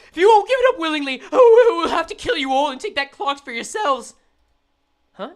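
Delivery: mock menacing gangster; threatening thug. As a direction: deliver a hammed-up robber's threat. Voices thug threatening to kill.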